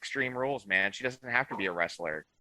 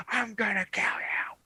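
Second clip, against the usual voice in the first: higher, over-the-top mock tough guy threat; mock wild threat; 13 semitones higher than usual. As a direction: ham up a raging tough guy. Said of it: "And it wasn't like".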